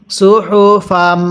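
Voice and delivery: slow, drawn-out Quran syllables; measured teaching recitation; model recitation. Shown as a demonstration. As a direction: stretch each syllable for learners to follow. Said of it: "কিভাবে পড়ব".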